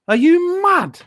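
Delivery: high exclamation of outrage; outraged disbelief. Real, not acted. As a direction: throw it at the screen. Outraged at losing.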